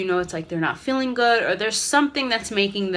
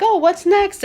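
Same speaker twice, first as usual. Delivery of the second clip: much higher and louder, bright surprise; cheerful distraction; 12 semitones higher, 8 dB louder than usual. Retold or imitated. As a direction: pull the child's attention onward. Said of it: "be like".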